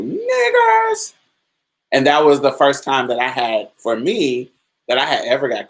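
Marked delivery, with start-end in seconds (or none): 0.0-1.1 high-pitched, drawn-out mock scream; imitated scream. Passenger yelling from a departing train window, imitated scream. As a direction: imitate a yell pitched far above your usual voice. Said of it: "he screams out the window".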